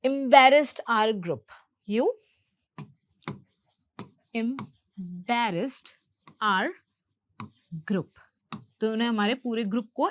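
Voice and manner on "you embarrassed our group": slow dictation with long pauses between words. Shown as a demonstration. Teacher giving learners the sentence to copy, word by word. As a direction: break the sentence into single, separate words.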